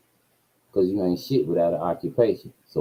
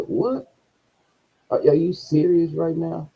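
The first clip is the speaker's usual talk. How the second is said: high, incredulous what; learning he is fired, a high incredulous yelp; shocked disbelief; 9 semitones higher than usual. Retold or imitated. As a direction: leap up in pitch at the news. Shocked reaction to being fired.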